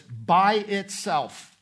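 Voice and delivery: "by itself" drawn out slowly, each word weighted; stress on "by itself". Shown as a demonstration. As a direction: lean hard and slow on "by itself". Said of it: "It's meant to emphasize something".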